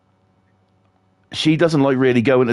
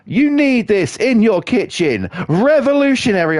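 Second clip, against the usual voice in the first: high, hyped-up sales pitch; high, pushy mock infomercial hype; mock TV salesman; 8 semitones higher than usual. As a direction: oversell it like a shopping channel host. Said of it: "he was saying".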